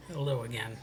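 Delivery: ordinary talk, nothing marked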